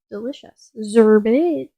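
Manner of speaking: loud aggressive Zerbit. Shown as a demonstration. Gamer voicing Ribot's catchphrase aggressively. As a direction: bark Zerbit hard. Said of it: "the Zerbit is really aggressive".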